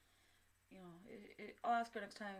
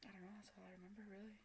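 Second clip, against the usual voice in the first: soft voiceless whisper, fading; whispered, trailing off; 13 dB quieter, faster than usual. Real, not acted. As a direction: barely breathe it out. Trailing whisper about the dream.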